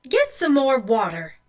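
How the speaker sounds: loud, commanding mother; stern order. A narrator's reading. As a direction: order her off with force. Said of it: "Mother ordered".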